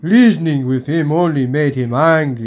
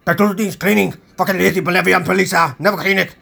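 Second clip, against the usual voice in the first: retold snarl, pitched up and tense; a higher, mock-angry Mike snarl; 5 semitones higher, faster than usual. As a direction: spit it out with contempt at the lazy police. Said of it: "he snarled".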